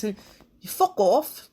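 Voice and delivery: slow, a hint of a prison-drama accent; lightly voiced tough inmate; Wentworth impression. Quoting the show's line in a mild Wentworth voice. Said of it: "in my best Wentworth voice".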